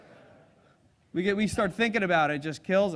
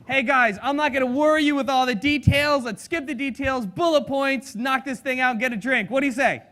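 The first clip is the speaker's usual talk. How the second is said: loud, high, upbeat mock pitch; pitching to planners; 5 semitones higher, 9 dB louder than usual. Addressing the planners as a group, loud and upbeat. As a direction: sell them on skipping the details.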